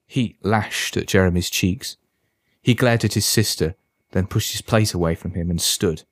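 ordinary delivery

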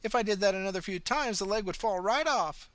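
put-on high, sweet old lady voice; old woman. A narrator's reading. Old pensioner cheerfully greeting the ambulance officer, high and sweet. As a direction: chirp it like a kindly granny.

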